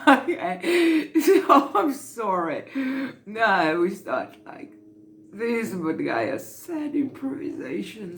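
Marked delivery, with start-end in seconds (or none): 0.1-2.6 higher, louder apology through real laughter; laughing apology. Real, not acted. Speaker apologizes to camera while still laughing, higher and louder.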